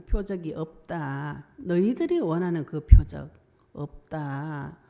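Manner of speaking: very slow, weighty words with a loud middle; solemn stern refusal; slow emphatic declaration. Acted. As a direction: drag it out for emphasis. Quoting Jesus, slow emphatic.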